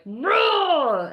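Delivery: loud, high roar of exasperation; frustrated roar. Retold or imitated. Acting out the frustration felt in the body, roaring. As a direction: let the pent-up annoyance burst out. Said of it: "I could feel it in my body, like".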